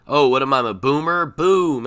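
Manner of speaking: big loud hyped-up boomer joke; loud imitating streamer hype; loud mock Doc catchphrase. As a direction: belt it out like a streamer's intro bit. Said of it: "He says something like boom".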